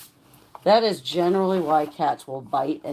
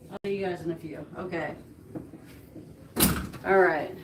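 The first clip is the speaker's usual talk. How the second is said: normal talking voice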